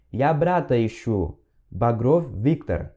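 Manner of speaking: slow, careful Russian for learners; slow teaching read. Shown as a demonstration. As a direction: spell each word out slowly. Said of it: "Ok, mais uma vez". (leitura lenta e didática)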